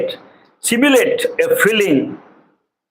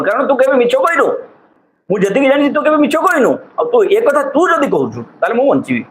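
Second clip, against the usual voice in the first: relieved patient; 7 semitones higher, faster than usual. Acted.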